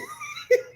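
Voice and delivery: short high-pitched laugh. Real, not acted. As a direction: let out a quick squeal of laughter. Laughs at own joke.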